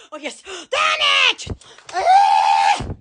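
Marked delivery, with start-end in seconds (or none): none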